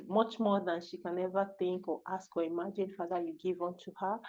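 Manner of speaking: soft, earnest spoken prayer; hushed, even prayer of thanks; closing prayer. Real, not acted.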